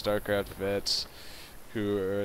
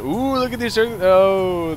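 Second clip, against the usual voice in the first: surprised; 8 semitones higher, 7 dB louder, slower than usual. Real, not acted. Sudden exciting play spotted.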